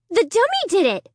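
high, insistent denial. A narrator's reading. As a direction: press the point hard. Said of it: "I insisted".

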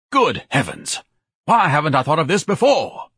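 high, loud self-amazed exclamation; excited realization. Acted. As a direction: exclaim grandly at a sudden revelation. Bellowing orator carries on.

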